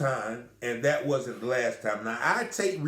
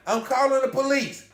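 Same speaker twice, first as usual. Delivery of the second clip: high, loud mock yell; imitating her yelling a threat on her way out; retold threat; 10 semitones higher, 4 dB louder than usual. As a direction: shout it back over your shoulder as you run. She runs out threatening police.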